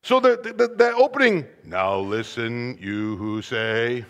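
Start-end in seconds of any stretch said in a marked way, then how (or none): 1.6-4.0 low, soft, slow, no force; understated low reading that misses the point; flat low reading. Shown as a demonstration. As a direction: say it low and slow, as a weak translation. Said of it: "is not quite getting it".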